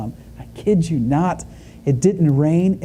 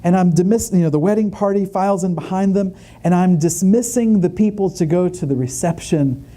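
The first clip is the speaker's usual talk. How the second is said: ordinary talk, nothing marked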